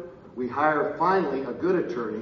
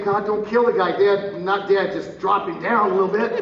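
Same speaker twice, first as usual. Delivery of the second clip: louder, higher plea to God; raised, hammed-up prayer; comic bargaining prayer; 5 semitones higher, 6 dB louder than usual. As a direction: haggle loudly with heaven.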